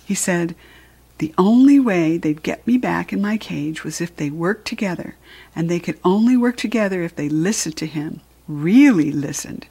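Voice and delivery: ordinary delivery, nothing marked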